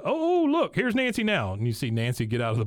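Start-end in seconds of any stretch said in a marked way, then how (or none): 0.0-1.5 high-pitched, swooping "oh, look"; mock surprised spotting. Retold director spots Nancy arriving, in high mock surprise. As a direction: imitate someone suddenly noticing an arrival.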